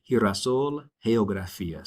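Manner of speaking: girasol, geografía with G said as H; soft G sound. Shown as a demonstration. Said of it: "it's a soft sound".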